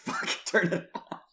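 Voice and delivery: high, loud exasperated outburst; hammed-up high yelp of annoyance; mock exasperation. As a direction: cry out as if you can't bear it. Acting out disgust at own laugh.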